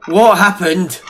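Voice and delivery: loud, high startled outburst; shocked shout. Acted. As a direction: blurt it out in shock. After floating incident.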